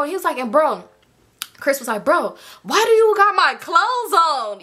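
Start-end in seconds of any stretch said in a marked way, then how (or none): 2.0-4.6 high, slow, stretched-out question; hammed-up disbelief; mock incredulous outcry. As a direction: imitate someone loudly baffled, dragging out the words. Chris/G confrontation acted out.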